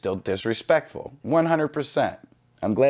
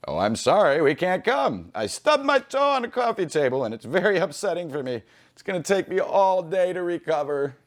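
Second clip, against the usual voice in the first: high, overdramatic put-on voice, milking the stubbed toe; mock whiny excuse; 5 semitones higher than usual. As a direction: play the fake victim, whining. Second mocking fake excuse.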